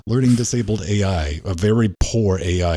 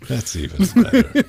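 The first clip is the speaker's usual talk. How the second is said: laughing; 12 semitones higher, 4 dB louder than usual. Real, not acted. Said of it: "repeat said laughing".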